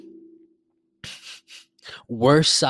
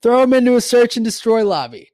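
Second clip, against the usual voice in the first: high, loud outburst; hyped-up exclamation; 9 semitones higher, 8 dB louder than usual. Real, not acted. Daring listeners to throw them into a lobby, hyped up. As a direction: belt it out high and emphatic. Hyped-up repeat of his challenge.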